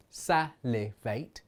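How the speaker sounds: "salivate" split into three slow beats; slow, chopped-up "salivate" for counting; syllable by syllable. Shown as a demonstration. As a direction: say "salivate" in three separated pieces. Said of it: "alone has three syllables".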